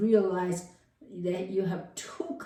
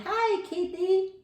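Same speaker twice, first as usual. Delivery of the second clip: high, slow coo to the cat; sweet greeting; 13 semitones higher, slower than usual. Pretend. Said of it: "if the normal you".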